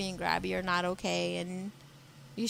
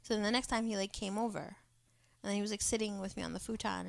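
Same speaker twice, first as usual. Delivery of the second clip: normal talking voice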